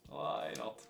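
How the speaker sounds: "Why not?" breathed out as a whisper; mock-defeated whisper of "Why not?"; sarcastic whisper. Real, not acted. Sarcastic resignation, repeated.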